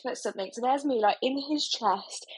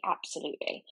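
softer, breathy throwaway yes; quiet aside; 5 semitones lower, 6 dB quieter than usual. Shown as a demonstration. Listing her nursing skills to the host, quiet aside. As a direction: drop it to an almost unvoiced aside. Quick self-answer, dropped voice.

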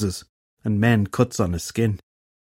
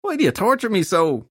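high, melodramatic hungover moan; pained moan; 11 semitones higher than usual. A narrator's reading. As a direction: moan it like a tormented patient. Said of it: "Filthy Henry moaned".

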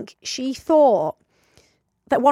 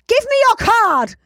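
much higher, louder, drawn-out demand; mock yell; 12 semitones higher, 6 dB louder, slower than usual. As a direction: yell it at her like an impatient kid. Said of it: "And Ashley's going".

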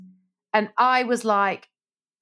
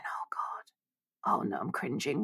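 hushed panicked whisper to herself; a dread-filled whisper under the breath; 7 semitones lower, 12 dB quieter, slower than usual. Retold or imitated.

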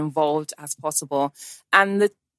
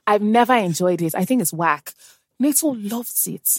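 normal talking voice

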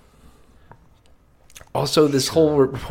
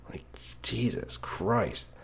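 exasperated whisper; 9 dB quieter, slower than usual. Real, not acted. Exasperated aside.

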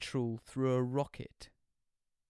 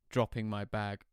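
ordinary delivery, nothing marked

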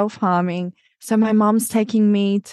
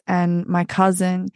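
ordinary talk

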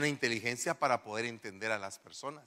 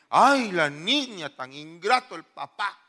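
lamento exagerado, agudo y fuerte, alargando las palabras; reproche imitado; 3 semitones higher, 9 dB louder, slower than usual. Acted. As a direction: imita a alguien escandalizado que critica al papá. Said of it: "Alguien podría decirme". (queja fingida de indignación)

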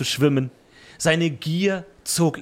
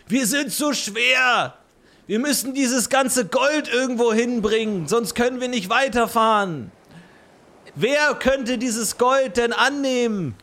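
frantic sailor voice; 11 semitones higher, 4 dB louder than usual. Acted. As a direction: shout it like a desperate sailor. Sailors in panic over gold.

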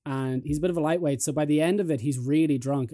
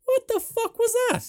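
high, slow, shocked voice; much higher disbelieving drawl; mock stunned friend; 3 dB louder than usual. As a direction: play the friend aghast. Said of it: "and he goes".

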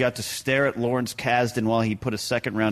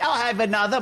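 high-pitched put-on British accent; child trying out a British accent; mock British accent; 10 semitones higher than usual. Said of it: "ordering pizza from a British dude".

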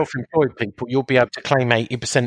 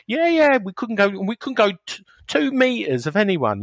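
pitched up, insisting it really happened; insisting grandparent; 8 semitones higher than usual. Retold or imitated. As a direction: swear to the kids it's true.

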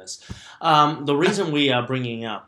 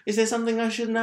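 mock suspicious; 10 semitones higher, faster than usual. Teasing co-host.

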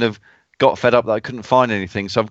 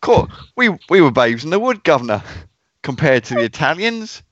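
higher-pitched put-on Cockney; best Cockney; 4 semitones higher than usual. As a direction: ham up a Cockney accent for a mate. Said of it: "You best Cockney".

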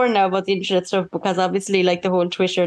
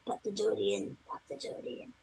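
voiceless whisper, fading even softer; whispered aside; 16 dB quieter than usual. Real, not acted. Host reminding herself mid-recommendation, in a fading whisper. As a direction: murmur it under your breath to yourself.

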